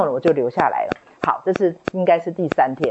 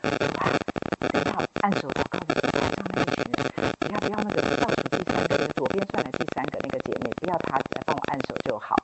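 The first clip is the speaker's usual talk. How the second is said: 压低到几乎无声的耳语; 像怕被人听见一样悄悄求主; 偷偷祷告; 10 dB quieter, faster than usual. Retold or imitated. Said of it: "一个很白痴的祷告". (悄声耳语般向主祷告)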